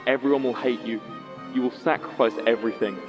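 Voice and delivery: ordinary talk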